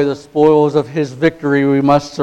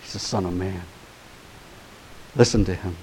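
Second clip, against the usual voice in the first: hushed; 4 semitones lower, 11 dB quieter than usual. Real, not acted. Quiet after preaching climax.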